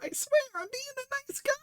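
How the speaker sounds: very high, fast squeak; mock pleading protest. Streamer insisting he is genuine, squeaky mock plea. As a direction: rush it out in a tiny high voice. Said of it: "I'm like".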